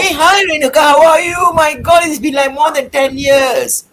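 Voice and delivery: excited greeting. Real, not acted. Guest greets host after years.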